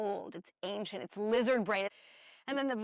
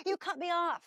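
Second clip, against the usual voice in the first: pitched-up indignant snap; mock frustration; 9 semitones higher, 4 dB louder than usual. Speaker imitating someone's frustration at being cut off, mock indignant. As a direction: play the driver who got cut off. Voicing road-rage frustration.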